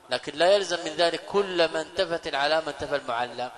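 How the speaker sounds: usual speaking voice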